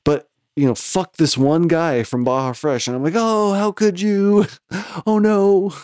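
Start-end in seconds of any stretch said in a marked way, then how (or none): 3.2-4.5 high, slow mock wail of how could you; melodramatic wail. Phone to girlfriend, high slow mock wail. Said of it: "So I'm doing, like, that all over the phone". 4.7-5.7 high, slow, wailing mock heartbreak; hammed-up heartbroken wail. On the phone to his girlfriend, high melodramatic wail. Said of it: "So I'm doing, like, that all over the phone".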